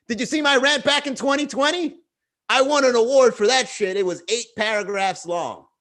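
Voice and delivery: high, smug braggart voice; mocking social media ranter. Comedian mocking a proud ranter, smug and high. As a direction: play a self-important poster.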